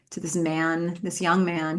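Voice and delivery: ordinary delivery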